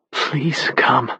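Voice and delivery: breathy, desperate whisper; a whispered plea, barely holding together. Acted. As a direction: plead in a whisper on the voicemail. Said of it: "Any attempt at hiding the desperation was gone".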